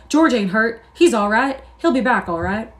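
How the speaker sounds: loud, high, placating; backpedalling in fear; hasty reassurance. A narrator's reading. Crooks backing off, scared.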